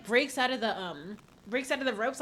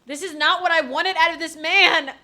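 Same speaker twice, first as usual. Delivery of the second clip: exasperated complaint; 5 semitones higher, 11 dB louder than usual. Real, not acted. Complaining about character.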